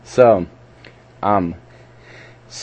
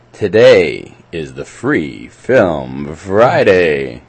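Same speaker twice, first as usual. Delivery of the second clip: slow, deliberate announcer delivery; drawn-out mock announcer; 4 semitones lower, much slower than usual. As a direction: articulate each word like an announcer. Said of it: "with an announcer voice".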